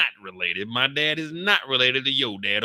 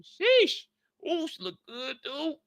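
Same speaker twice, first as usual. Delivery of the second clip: impressed ogling; 12 semitones higher, 5 dB quieter, slower than usual. Retold or imitated. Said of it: "he's sizing her up like".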